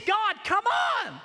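high, loud drawn-out plea; slow, straining shout of prayer; pleading cry. Retold or imitated. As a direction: stretch it out, begging God.

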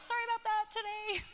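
high, soft singsong apology; mock-sweet high voice; sheepish apology. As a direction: pipe an apology to the neighbours. Apologising to neighbours after screaming.

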